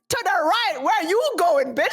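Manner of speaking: angry retort. Retold or imitated. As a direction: throw it back like a fed-up driver. Heated retelling of driver argument.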